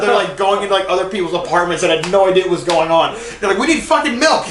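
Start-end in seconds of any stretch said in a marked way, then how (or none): 3.6-4.5 high, laughing mock urgency; frantic retold demand. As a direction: imitate friends pleading in a panic. Retells friends' frantic milk hunt.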